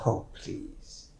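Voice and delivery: soft unvoiced scoff; whispered oh please. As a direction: brush it off in a whisper. Dismissing partner's remark.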